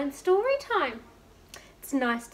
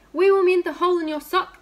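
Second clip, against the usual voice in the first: higher, louder and fast, eager to help; eager menders; 6 semitones higher, 9 dB louder than usual. Acted. Royal menders eagerly reassuring the king, high and fast. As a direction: rush the promise out brightly.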